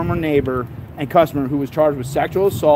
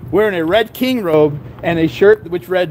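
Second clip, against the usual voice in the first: ordinary talk, nothing marked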